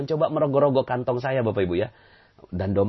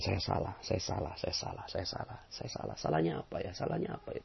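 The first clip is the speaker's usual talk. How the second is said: soft, fading self-reproach murmur; quiet murmured repetition; 4 semitones lower, 12 dB quieter than usual. Shown as a demonstration. Teaching the audience to murmur saya salah softly. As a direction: murmur it under your breath, fading to nothing.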